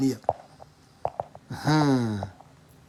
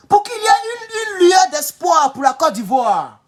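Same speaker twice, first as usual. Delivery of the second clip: impassioned cry; 9 semitones higher, slower than usual. Real, not acted. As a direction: cry it out for your people. Dramatic plea for the country.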